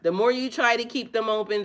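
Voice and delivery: ordinary delivery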